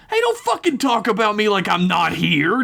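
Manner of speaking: high-pitched indignant outburst; spat out. A narrator's reading. Jason butting in between Rio and snake, indignant high outburst. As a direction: spit it out, pitch way up. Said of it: "Jason spat out".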